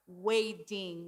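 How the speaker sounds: "wading" said slowly, the D sounded out clearly. Shown as a demonstration. Preacher to congregation, slowly sounding out "wading". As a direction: spell out the D in "wading". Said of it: "with a D".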